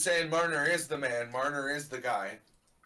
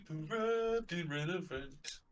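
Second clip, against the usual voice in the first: drawn-out, quieter ad read; slow mock announcer; 3 semitones higher, 5 dB quieter, much slower than usual. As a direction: stretch every word like a commercial.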